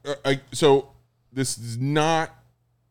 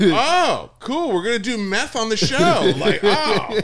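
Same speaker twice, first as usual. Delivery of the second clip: mock enthusiasm; 5 semitones higher, 4 dB louder, slower than usual. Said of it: "I was like".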